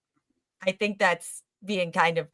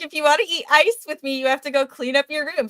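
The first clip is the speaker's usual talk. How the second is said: higher, quick coaxing mom; bargaining mom; 6 semitones higher, faster than usual. Retold or imitated. Mom bribing the child with ice to clean up, coaxing. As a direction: sweet-talk the kid into cleaning. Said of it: "She was like".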